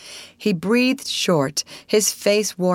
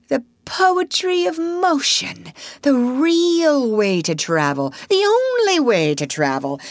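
rapturous; 7 semitones higher, slower than usual. A narrator's reading. Toad rapturous about motoring.

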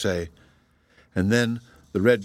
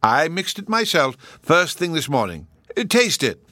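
lightly voiced jolly old professor, raised; bustling, pleased old scholar; 7 semitones higher, 3 dB louder than usual. A narrator's reading. Professor tells the children they are expected, jolly.

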